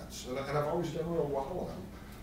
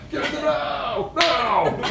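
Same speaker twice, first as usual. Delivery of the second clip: mock confrontational yell, pitched way up; Fritz Perls; 11 semitones higher, 7 dB louder, slower than usual. Shown as a demonstration. Trainer imitating a confrontational therapist at clients, high loud yell. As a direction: shout it at the webcam, hammed-up. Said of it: "I can yell at the webcam".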